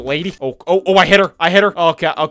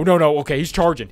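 normal talking voice

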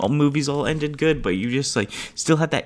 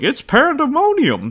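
high, louder, slow announcer cry; hammed-up announcer; 6 dB louder, slower than usual. Announcer calling the chaos, hammed-up high cry. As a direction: play the ringside announcer, overblown. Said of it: "the announcers".

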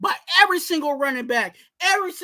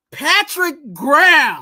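loud, slow name call; 6 dB louder, slower than usual. Acted. Host addressing Patrick Graham in his segment, calling out. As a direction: stretch the name out, loudly scolding. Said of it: "Who was you yelling at".

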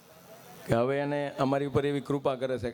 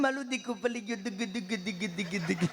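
mock baby talk; 4 semitones higher than usual. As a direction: coo over the fallen child. Mother cooing over fallen son.

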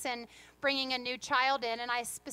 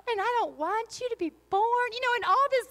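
put-on higher, sugary little-boy voice; sweet little toddler voice; 8 semitones higher, 7 dB louder, faster than usual. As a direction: coo it like a sweet toddler to the baby. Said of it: "like, sweet voice".

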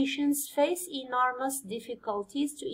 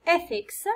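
ETHICS with the first syllable stressed higher; 6 semitones higher than usual. Shown as a demonstration. Teacher showing students where stress falls, first-syllable stress on ethics. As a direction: say ethics as ETH-ics, leaning on ETH. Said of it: "stress يجي في السيليبل الأول".

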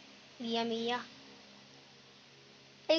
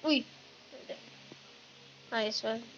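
normal talking voice

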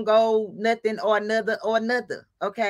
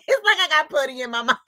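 laughing; 7 semitones higher, faster than usual. Real, not acted. Laughing at own tongue.

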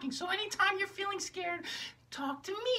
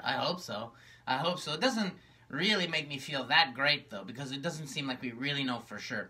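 gloomy low puppet. Acted. Magenta tells her sister how lonely she is, low and gloomy. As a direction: sigh it out like a sad puppet.

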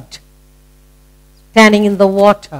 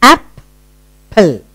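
"Apple" in two parts with a clear gap; split into syllables. Shown as a demonstration. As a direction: break "Apple" after the first syllable. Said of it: "two syllable word".

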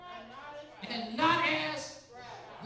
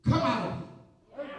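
commanding rebuke; slower than usual. Acted. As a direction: drive the spirit out, word by word. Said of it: "That's authority".

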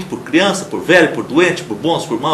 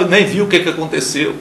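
normal talking voice

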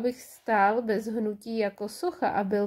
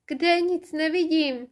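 high, louder shout of Kde; cry out; 6 semitones higher, 4 dB louder than usual. A narrator's reading. Bing cries out to Sula, high and loud. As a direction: shout it out eagerly. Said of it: "vykřikla Bing".

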